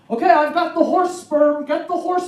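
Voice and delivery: high, loud, slow teasing announcement; joking doctor. Retold or imitated. Doctor teasing the comedian before insemination, mock announcement. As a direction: ham up the doctor's prank. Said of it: "he walks in the room and goes".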